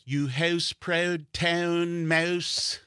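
very slow, high, hammed-up Canadian accent. Comic imagining Pink Floyd as Canadians, exaggerated Canadian accent. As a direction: stretch every vowel in mock Canadian. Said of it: "It, the Canadian accent".

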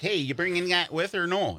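put-on Wisconsin accent. Newcomer to L A asking friends along, Wisconsin accent. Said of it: "I have an accent and I say weird words".

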